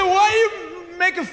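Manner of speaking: high, halting mock sob; crying kid. Young clerk feels mocked by customer, high tearful stammer. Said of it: "He started crying".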